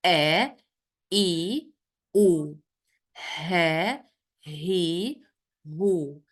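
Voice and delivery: throat letters from "A, E, U" to "خو", each syllable separate and drawn out; careful teacher's model of Arabic throat sounds, one syllable at a time; letter pronunciation drill. Shown as a demonstration. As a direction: recite each letter with its three vowels, pausing between. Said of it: "pronounce them".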